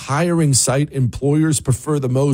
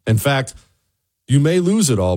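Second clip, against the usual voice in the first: ordinary talk, nothing marked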